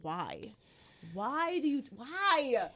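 normal talking voice

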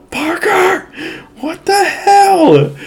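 high, stretched-out mom shriek from the other room; mom yelling. Retold or imitated. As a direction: shriek it like a furious mom who just found the mess. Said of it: "I just hear my mom yell".

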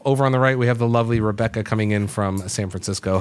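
usual speaking voice

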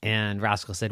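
ordinary delivery

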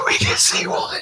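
breathy echoed whisper. Pretend. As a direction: whisper it back, amused. Echoing the blurb in a whisper.

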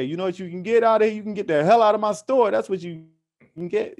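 acted storekeeper yelling get out; angry shopkeeper. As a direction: throw the guy out of your store, hammed-up. Store owner throwing him out.

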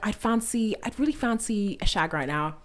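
a mild, shaky British accent; botched British accent. Shown as a demonstration. Friend demoing her British accent, mild botched British accent. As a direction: try a British accent, barely landing it. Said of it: "I'll botch a British accent".

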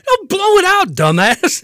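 hammed-up squealing yell; mock yell. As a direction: bark the insult in a shrill put-on voice. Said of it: "and he's, he's".

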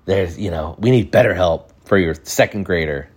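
ordinary delivery

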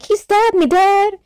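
put-on whiny kid monster. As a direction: squeak it out like a hurt little kid. Junior voiced in retelling.

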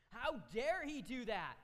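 outraged roar; furious king. Acted. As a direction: bellow it in outrage. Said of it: "The king is just furious".

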